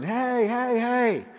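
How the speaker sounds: high, mock yell that stays light in volume; calling out. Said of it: "So he yells to the person".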